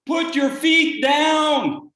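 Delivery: loud, slow shout from below; called up. Retold or imitated. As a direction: call up the cliff, drawn out. People calling up from below.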